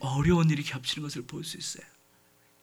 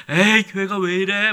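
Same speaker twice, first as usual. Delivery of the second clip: mock complaint, a burst louder; grumbling; 7 dB louder than usual. Church member blaming the church, grumbling. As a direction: grumble it out loudly, imitating a complainer. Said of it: "원망하게 하고 불평하게 하고".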